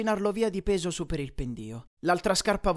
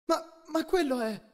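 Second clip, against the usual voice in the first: high, halting disbelief; startled, breaking off unfinished; alarmed stammer; 10 semitones higher than usual. A narrator's reading. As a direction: stumble over the words, pitched up.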